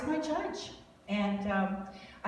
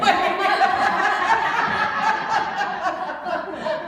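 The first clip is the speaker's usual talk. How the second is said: long burst of laughter; 10 semitones higher, 10 dB louder than usual. Real, not acted. Laughing at own football confusion.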